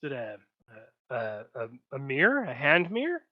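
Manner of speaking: halting, then rising into a question; tentative, puzzled guess after thinking; hesitant guess. Acted. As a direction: stammer your way to an unsure answer. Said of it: "she thinks for a little bit".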